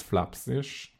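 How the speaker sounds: ordinary talk, nothing marked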